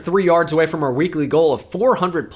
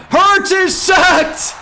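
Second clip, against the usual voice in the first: high, loud, slow sports-call shout; big play-by-play shout; excited shout; 5 dB louder, much slower than usual. Real, not acted. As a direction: yell the sack like a commentator. Game-deciding sack call.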